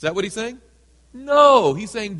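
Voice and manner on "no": loud, high burst; emphatic no. Real, not acted. Preacher rejecting the wrong reading to the congregation, loud. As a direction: slam the idea down.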